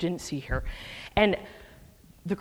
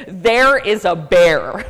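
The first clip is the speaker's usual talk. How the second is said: loud warning; 11 dB louder, much slower than usual. Retold or imitated. Warning hikers behind about bear.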